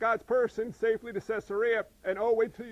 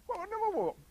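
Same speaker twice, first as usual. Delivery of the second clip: high-pitched, startled objection; mock alarm; 12 semitones higher than usual. Preacher acting out objection to God's plan, mock alarm. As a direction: halt someone in high-pitched disbelief.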